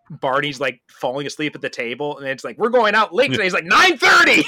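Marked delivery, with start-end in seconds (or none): 2.6-3.4 mock shout, high and loud; excited announcement. Sitcom friends announcing a big night out, loud mock excitement. 3.6-4.4 very high, loud comic yell; mock triumphant shout. Sitcom friend announcing a late night, high mock shout.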